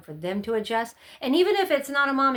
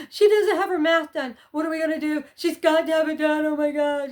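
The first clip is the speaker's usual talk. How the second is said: high, louder panicked rush; 6 semitones higher, 5 dB louder than usual. Retold or imitated. Mom at night, panicking over unfinished math. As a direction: sound frantic about the homework. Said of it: "'cause I'm like".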